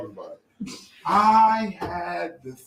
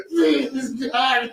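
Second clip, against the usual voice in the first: higher, crying out; crying praise; 4 semitones higher than usual. Retold or imitated. Forced to praise, crying out please. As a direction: wail it like praising. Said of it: "I was praising God".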